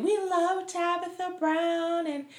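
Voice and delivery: put-on high, slow little girl voice; a child talking about her idol. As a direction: mimic a sweet little girl, slowly. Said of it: "was basically saying".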